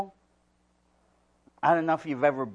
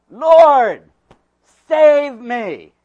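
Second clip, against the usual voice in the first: loud, high desperate cry for help; 7 semitones higher, 16 dB louder, much slower than usual. Acted. Peter sinking, crying out loud to the Lord. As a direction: shout it like a sinking man. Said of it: "he cried out".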